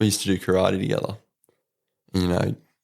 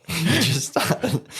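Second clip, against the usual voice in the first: slow, higher, laughing through it; cracking up while telling it; words squeezed out through laughter; 8 semitones higher, much slower than usual. Real, not acted. Retelling dad laughing at him.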